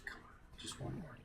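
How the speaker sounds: breathless hushed urging; a held-breath plea under the voice; tense whisper. Real, not acted. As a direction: whisper it so nothing hears you. Tense sneaking for last crystal.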